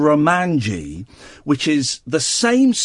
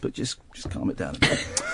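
whispered aside; 9 semitones lower, 11 dB quieter than usual. Real, not acted. Host hushing studio laughter.

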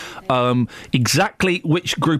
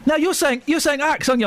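challenging; 9 semitones higher than usual. Real, not acted. Host challenges caller's pronunciation.

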